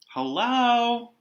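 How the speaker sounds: put-on high, loud call of a little girl; girl calling hello. Addie calling hello as she steps inside, high and loud. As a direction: sing out a bright hello on entering.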